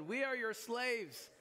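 high, slow, laughing mock plea; hammed-up groveling. Brothers throw themselves before Joseph, high mock plea.

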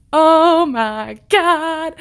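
slow, high frightened "oh my god"; scared gasp. Retold or imitated. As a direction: draw it out, spooked. Scared reaction to knocking.

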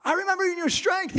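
high-pitched, insistent reminder; insistent accuser. Acted. Witness from the garden to Peter, high and insistent.

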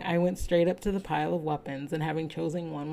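usual speaking voice

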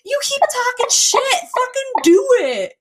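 piercingly high mock shriek; Jerry Springer girl screaming. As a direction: replay a drunken talk-show meltdown. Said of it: "I was like Jerry Springer girl".

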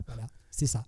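normal talking voice

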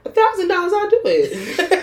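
jumps high, suddenly keen; half-laughing eager agreement; eager yes. Real, not acted. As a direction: snap up the offer with a laugh. Sudden eager acceptance of price.

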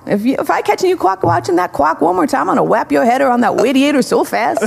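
high, hammed-up scolding teacher; stern teacher voice. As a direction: play the grumpy teacher threatening a kid. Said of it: "wanna hear the whole thing?".

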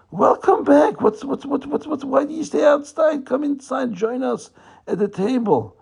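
very high, gushing host; hammed-up, high-pitched hospitality; effusive welcome. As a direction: usher the guest in eagerly. Leader welcomes the visitor inside.

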